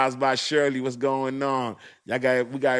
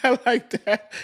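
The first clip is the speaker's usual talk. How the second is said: high-pitched words through real laughter; cracking up while talking; squeezed out through a big laugh; laughing hard; 12 semitones higher, 4 dB louder, slower than usual. Real, not acted. Cracking up at chat's joke.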